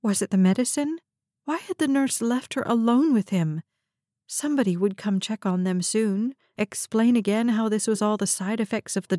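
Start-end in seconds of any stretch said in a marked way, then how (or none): none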